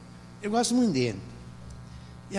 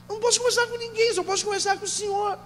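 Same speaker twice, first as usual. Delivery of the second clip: higher-pitched retold plea; softer, high and urgent; pleading mother; 10 semitones higher, 4 dB quieter than usual. Retells mother's plea to pastor.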